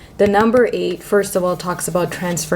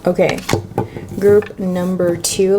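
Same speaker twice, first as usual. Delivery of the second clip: ordinary talk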